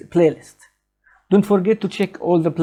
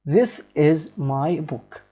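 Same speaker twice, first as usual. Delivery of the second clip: each word slowly and evenly; slow example sentence; much slower than usual. Shown as a demonstration. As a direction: read the example out slow and clear. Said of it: "to say these sentences".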